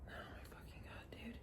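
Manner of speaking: whispered under the breath in disbelief; hushed, exasperated whisper; aghast whisper. Retold or imitated. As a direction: whisper it, appalled at a friend. Aghast aside in retold talk.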